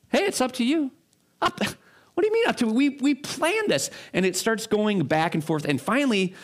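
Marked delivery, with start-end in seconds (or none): 0.1-0.9 laid-back friend. Retold or imitated. Relaxed friend replies. 1.4-3.9 much higher, climbing into mock outrage; hammed-up exasperated friend; escalating indignation. Uptight guy escalating.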